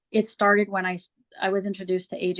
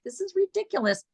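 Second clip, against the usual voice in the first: amused disbelief; 10 semitones higher than usual. Retold or imitated. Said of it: "I was like".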